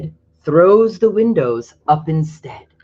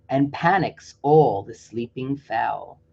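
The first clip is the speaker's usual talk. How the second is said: usual speaking voice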